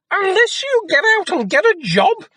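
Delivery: very high, loud shrieking scold; shouting mother. A narrator's reading. Mother yelling at lazy Jack, high piercing shout. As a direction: screech at your lazy son. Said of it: "she shouted".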